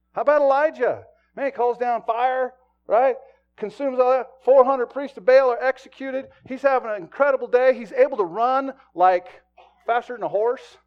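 loud, driving sermon delivery; full-force preaching. Acted. Pastor preaching hard to his church, loud and driving.

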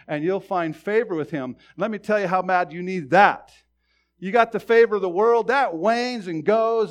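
impassioned preacher building in volume; forceful preaching. Real, not acted. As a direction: drive it home to the congregation.

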